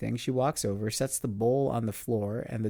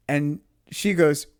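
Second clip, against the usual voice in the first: plain reading voice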